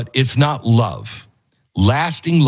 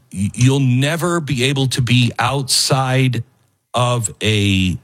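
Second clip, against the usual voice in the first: normal talking voice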